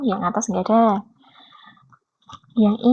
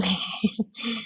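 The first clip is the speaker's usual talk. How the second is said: soft laugh; 11 dB quieter than usual. Real, not acted. Joke about Zimbabwe language.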